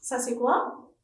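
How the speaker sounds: ordinary delivery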